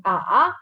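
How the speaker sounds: "ah" dropping into the throat, then up; third tone. Shown as a demonstration. Teacher says feel it in your throat, dipping "ah". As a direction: hook "ah" low and bring it back. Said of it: "Should feel it in your throat".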